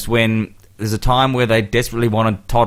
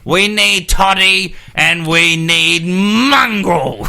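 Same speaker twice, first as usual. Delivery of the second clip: higher, very slow dopey fan voice; mocking Canterbury fans; 6 semitones higher, 3 dB louder, much slower than usual. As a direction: drag it out like a thick fan. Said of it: "He's a, just an arrogant Aucklander".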